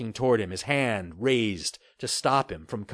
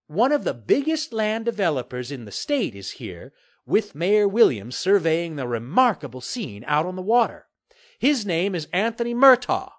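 lightly voiced on-camera reporter; 6 semitones higher than usual. A narrator's reading. Reporter introducing the developer to viewers, measured on-air delivery. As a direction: present it to camera, a little higher.

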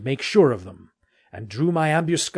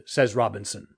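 usual speaking voice

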